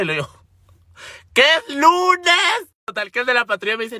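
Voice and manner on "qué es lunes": grito agudo y muy alargado; exasperación actuada. Retold or imitated. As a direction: reclama chillando, sílaba por sílaba. Estalla ante el policía. (chillido desesperado exagerado)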